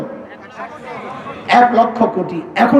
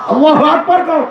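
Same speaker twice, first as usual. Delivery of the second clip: calling out; 6 semitones higher than usual. Real, not acted. Preacher calls crowd to respond.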